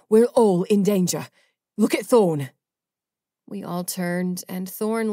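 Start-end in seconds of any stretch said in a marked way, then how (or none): 0.1-2.5 heated. A narrator's reading. Ajax arguing back heatedly.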